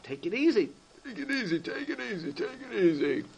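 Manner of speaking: soft, soothing repeats; calming. Acted. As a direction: settle him down gently. Secretary calms the crying Berel.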